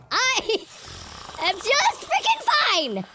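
high, slow, strained insistence; rising, barely-held-together protest; cracking composure. Real, not acted. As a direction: force it out word by word, climbing high. Cracks while insisting fine.

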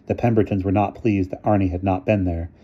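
ordinary delivery, nothing marked

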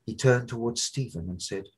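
ordinary delivery

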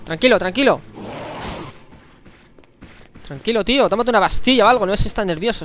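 loud frantic yelling. Real, not acted. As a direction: shout him down in a panic. Yelling at charging zombie.

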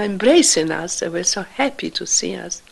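ordinary talk

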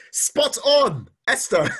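real laugh breaking through the words. Real, not acted. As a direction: laugh out the praise, genuinely amused. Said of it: "I'm laughing because".

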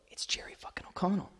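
hushed, starstruck whisper; awed whisper. Retold or imitated. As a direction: whisper the name like you can't believe it. Star knocks at his door.

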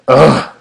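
a loud fed-up groan; bored groan. A narrator's reading. Rigby groans over washing carts. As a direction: groan like you're done with chores. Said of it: "Rigby groaned".

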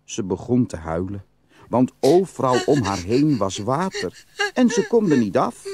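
ordinary delivery, nothing marked

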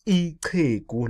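slow iqhegu with an airy click. Shown as a demonstration. Teacher showing learners the word, iqhegu clicked with a puff of air. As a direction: click the tongue clearly in iqhegu. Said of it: "Your tongue has to see cha".

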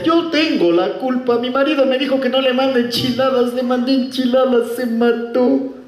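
hammed-up grieving wife; mock Mexican widow, high and tearful; crying Mexican widow. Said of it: "lloraba y decía".

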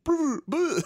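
high rapper brr yell; squealed brr ad-lib. Retold or imitated. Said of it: "just shit they yell".

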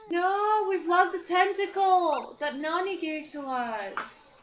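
loud, slow high wail of dismay; dismayed cry. Real, not acted. Player realising the gifted tentacle is gone, loud dismay. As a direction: cry out over the lost toy. Discovers lost gift tentacle.

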